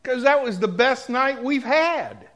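ordinary talk, nothing marked

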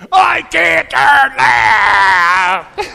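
stretched, high, yelled protest at the sat-nav; a friend yelling at his GPS, stretching out every word; loud screamed retort. Retold or imitated. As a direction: scream it back at the machine in exasperation. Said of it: "as loud as he can scream".

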